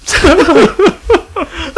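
high squeaky laugh-cry; laughing hard. Real, not acted. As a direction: crack up while talking. Laughing through words.

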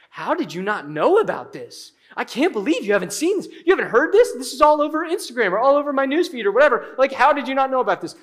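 high, rapid mock disbelief; rubbing it in. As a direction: pester like an annoying know-it-all friend. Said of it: "they wanna rub it in your face".

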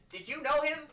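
high, loud accusing question; agitated wizard. Acted. Wizard questions the player about the intruder, agitated. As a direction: demand it with the voice flying up. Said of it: "he stops and asks".

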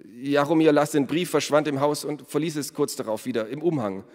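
nervous accused smith. A narrator's reading. (nervöser Schmied, höhere Stimme)

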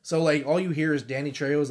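ordinary talk, nothing marked

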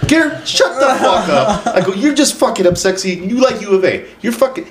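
high-pitched mock exasperation; retold heated comeback. Host telling customer Garrett to shut up, mock exasperated. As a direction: act out shutting down a friend.

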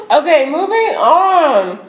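talking through a mouthful of popcorn; chewing while speaking; mouth full. Real, not acted. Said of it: "Sorry, I'm eating my popcorn".

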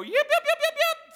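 very high, loud laughing yips; shepherd's call. Shown as a demonstration. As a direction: sing out the yips like calling a flock. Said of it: "heard the shepherd go".

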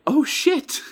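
high startled exclamation; surprised oh shit. Retold or imitated. He reacts to the fan leaving for class, high and startled. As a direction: jolt of surprise. Realizing she must leave.

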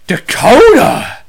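a mother catching her son; sharp call. Retold or imitated. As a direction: mom calling him out. Mom catching kid cussing.

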